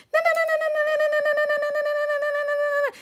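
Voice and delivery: high, loud sing-song taunting na-na; mock the haters in a squeaky childish chant. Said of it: "this example of what's happening".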